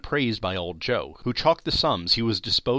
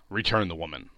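ordinary delivery, nothing marked

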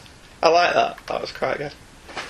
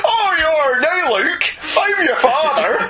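higher, slow Northern English Vader; 10 semitones higher, slower than usual. Retold or imitated. Vader tells Luke he is his father in a Northern accent. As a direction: drag out the reveal like a farmer dad.

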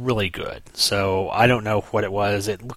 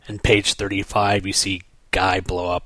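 normal talking voice

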